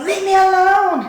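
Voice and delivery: high, loud cry to be left alone; panicked shout. Retold or imitated. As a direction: scream it out, desperate.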